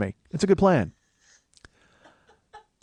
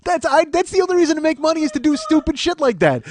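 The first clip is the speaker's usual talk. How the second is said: high, loud, giddy outburst with laughter; wildly excited; 13 semitones higher, 7 dB louder than usual. Real, not acted. Host dreaming of a giant chocolate fondue pot, giddy high-pitched excitement. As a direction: burst out laughing, pitch shooting way up. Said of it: "how excited I am".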